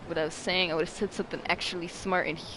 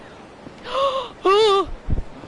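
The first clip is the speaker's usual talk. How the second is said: sharp high gasp; a loud startled gasp; big gasp; 8 dB louder than usual. Real, not acted. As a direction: gasp loudly in shock. Sudden loud gasp mid story.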